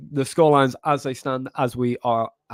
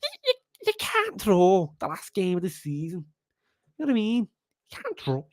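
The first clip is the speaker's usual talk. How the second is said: exaggerated Scouse accent, pitched up; Jamie Carragher; 5 semitones higher, 3 dB quieter than usual. Streamer mimicking a pundit on the last game, hammed-up Scouse. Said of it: "It's my Jamie Carragher".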